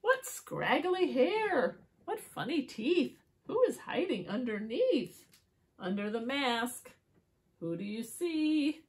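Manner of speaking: singsong rhyming story narration; riddle for children. A narrator's reading. Asking children who is under the mask, playful storytelling. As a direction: build up to a teasing question.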